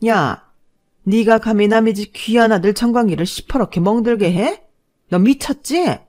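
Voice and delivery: furious mother climbing in pitch to the last question; outraged mother-in-law. Acted. Boyfriend's mother defending her bruised son, outraged. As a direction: confront her in indignant outrage.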